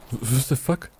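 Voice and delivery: sudden high disbelieving what the fuck; shocked exclamation. Real, not acted. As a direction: caught off guard by the game, blurting it out. Sudden shock at game event.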